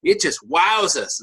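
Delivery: ordinary delivery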